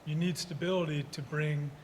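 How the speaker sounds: normal talking voice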